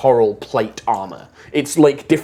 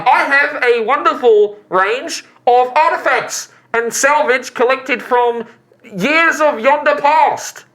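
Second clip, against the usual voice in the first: shopkeeper's sales pitch; 12 semitones higher, 4 dB louder than usual. Acted. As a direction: present the wares with ceremony, pitched up. Voices the shopkeeper.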